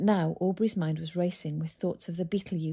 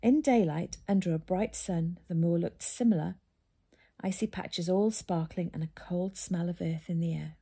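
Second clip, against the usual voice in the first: usual speaking voice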